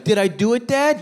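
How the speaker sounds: put-on high, rising little-kid question; eager young son. Son asks Dad after throwing, high eager kid voice. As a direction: pitch it up like a hopeful child. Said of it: "he's like".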